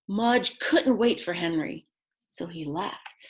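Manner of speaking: usual speaking voice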